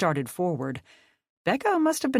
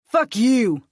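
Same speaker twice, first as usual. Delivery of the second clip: high, loud defiant curse; angry defiant retort; shot back; 8 semitones higher, 8 dB louder than usual. Acted. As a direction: fire it straight back. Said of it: "Reed shot back".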